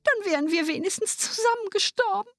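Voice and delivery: put-on high-pitched, plaintive girl's voice; wailing lament. A narrator's reading. Poor sister in tears over their fate, wailing. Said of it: "wie jammerte die arme Schwester".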